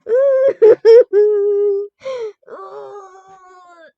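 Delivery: long, high, loud wail; mock crying howl that tapers off; hammed-up wail. Mock wailing after the fight.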